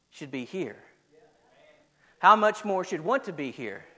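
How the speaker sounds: hushed, pensive pulpit appeal; subdued preaching. Real, not acted. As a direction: let each phrase hang heavily over the congregation. Preacher turns grave and slow.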